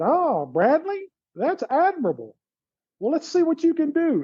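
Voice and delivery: high mock imitation of his old coach; imitating Dean Smith. Former player voicing his coach to podcast hosts, high mock coach voice. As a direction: pitch way up and play the coach, amused.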